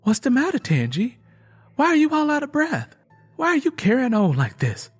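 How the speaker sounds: anxious questions rushed out; worried. A narrator's reading.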